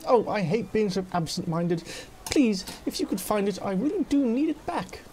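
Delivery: lightly voiced absent-minded bookworm; flustered Flydia. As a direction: fret over a lost book, ending high.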